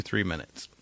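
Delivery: ordinary delivery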